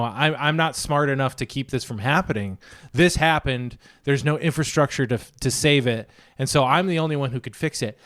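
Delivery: normal talking voice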